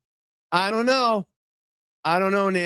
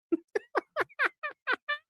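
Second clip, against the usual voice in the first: laugh; 7 dB quieter than usual. Real, not acted. Laughs at own joke.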